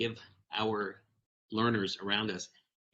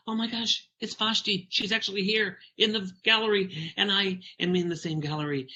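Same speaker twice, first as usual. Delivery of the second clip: put-on high, breathless starstruck kid voice; super excited boy; 8 semitones higher than usual. Boy spotting Vashti in the gallery, high starstruck gushing. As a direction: gush like a little boy who spots his hero. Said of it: "like, super excited".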